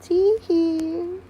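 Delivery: high, drawn-out mischievous tee-hee; playful tittering. Pretend. Playful catchphrase after admitting mischief.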